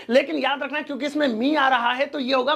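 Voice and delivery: usual speaking voice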